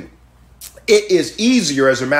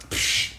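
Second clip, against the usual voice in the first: dismissive scoff; 9 dB quieter than usual. Retold or imitated. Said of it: "I could see a Cecilia Wampler just kinda".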